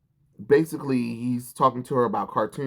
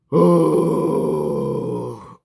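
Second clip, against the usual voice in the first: loud exasperated sigh; 4 semitones higher, 7 dB louder than usual. Real, not acted. Annoyed by the comic.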